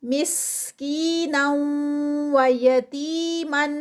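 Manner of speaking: مسکینا و یتیما recited very slowly with a long ی; یتیما with a stretched ی and a stop; Quranic recitation. Shown as a demonstration. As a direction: chant it slowly as a tajweed drill. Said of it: "یا مد ہے تو ٹو counts".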